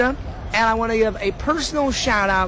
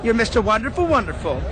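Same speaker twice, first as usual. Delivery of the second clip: ordinary delivery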